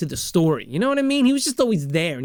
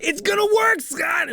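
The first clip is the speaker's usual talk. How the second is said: friend's eager voice; slower than usual. Retold or imitated. Stiles reassuring Scott, much higher eager voice. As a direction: pitch it way up like a cocky friend.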